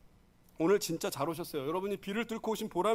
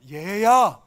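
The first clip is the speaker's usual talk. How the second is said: loud calling to the son; loud father calling; 11 dB louder, much slower than usual. Retold or imitated. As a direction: call out to your boy. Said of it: "아버지가".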